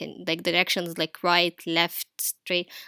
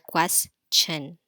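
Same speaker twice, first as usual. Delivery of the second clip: "question" split as ques, pause, tion; paused between syllables; 2 semitones lower than usual. Shown as a demonstration. Teacher showing pupils where the syllable falls, paused between syllables. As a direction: break "question" before its final syllable. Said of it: "like, when we pause".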